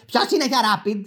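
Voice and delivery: high, piercing call to the queue; put-on high clerk voice; pharmacy employee's voice. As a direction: imitate a clerk calling out for the next patient. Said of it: "με τη φωνή που θα σας κάνω και την ένταση".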